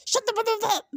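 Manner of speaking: imitating an angry outburst at a castmate; angry snap. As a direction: throw it out like he's lost it. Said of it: "he's so angry with Storm".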